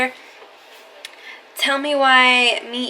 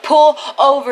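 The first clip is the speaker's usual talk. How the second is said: loud, firm pull over; retold loud demand; 3 semitones higher, 6 dB louder than usual. Girl in the back seat insisting the driver stop, loud firm demand. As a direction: insist on it, louder.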